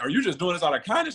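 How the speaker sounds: high, incredulous question; can't believe this kindness is real; retold wide-eyed surprise; amazed disbelief. Amazed at getting allowance.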